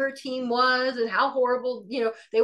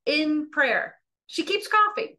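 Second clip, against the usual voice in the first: usual speaking voice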